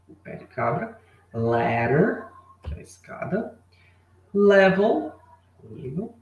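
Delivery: normal talking voice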